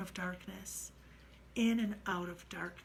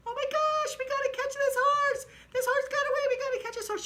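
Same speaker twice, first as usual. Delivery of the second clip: very high, loud squeal; delighted squealing friend; 10 dB louder, faster than usual. Retold or imitated. Jenny calling the narrator to see the horse, delighted high squeal.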